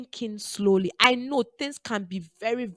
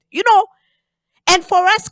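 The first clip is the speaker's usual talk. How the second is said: loud call out; 11 semitones higher, 9 dB louder than usual. Real, not acted. Preacher calling to congregation.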